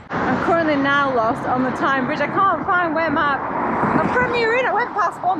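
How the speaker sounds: flustered. Real, not acted. Lost cyclist on the Tyne Bridge, flustered and high. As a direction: panic a little about being lost.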